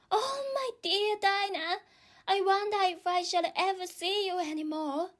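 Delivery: put-on high, tearful girl; a lonely girl about to cry; sad, near tears. A narrator's reading. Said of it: "began to cry again".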